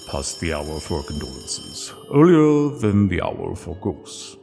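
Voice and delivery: ordinary delivery, nothing marked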